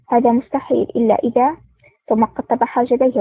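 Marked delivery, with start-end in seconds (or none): none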